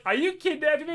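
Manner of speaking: high-pitched incredulous question; mock alarm. [name] is handed a hostage bag, mock alarm. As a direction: jump up high in imitated alarm.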